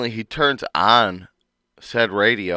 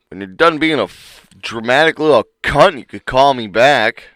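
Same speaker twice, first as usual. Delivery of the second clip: mock angry; 3 semitones higher, 7 dB louder than usual. Retold angry text from wife.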